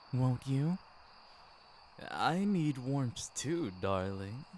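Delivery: ordinary delivery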